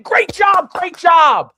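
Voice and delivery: high, loud, full-voiced shout; shouted praise. Coach praising a player at full intensity, shouting. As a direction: yell it out like a hyped-up coach. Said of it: "you could be saying".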